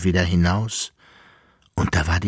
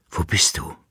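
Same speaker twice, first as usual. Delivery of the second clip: breathy unvoiced whisper asking where; 4 semitones lower, 3 dB quieter than usual. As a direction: whisper it into the dark. Flüstern im Traumdialog. (geflüstert)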